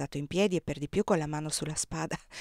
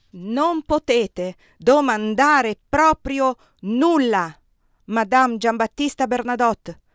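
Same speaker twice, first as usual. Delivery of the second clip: slow, furious roar, much louder; 4 semitones higher, 10 dB louder, slower than usual. A narrator's reading. The emperor roaring at Désirée, furious and slow. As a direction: bellow each word slowly at her. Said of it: "Ruggì".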